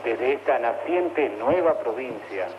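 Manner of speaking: slow, spaced-out dictation so it can be written down. Real, not acted. Radio host dictating a message to the other station, slowly and evenly. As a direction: dictate each phrase slowly and clearly.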